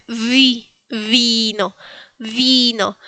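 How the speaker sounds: "vi, vino" with the V buzzed; vibrating V. Shown as a demonstration. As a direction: lean into a vibrating V at the start of "vino". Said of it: "es como una B más vibrante".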